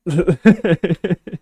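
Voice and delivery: bright burst of real laughter; high loud giggle. Real, not acted. Laughing at friend's silly face paint.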